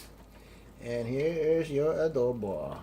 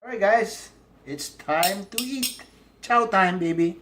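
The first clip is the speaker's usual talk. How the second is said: excited; 6 semitones higher, 8 dB louder than usual. Real, not acted. Excited vlogger announces eating time.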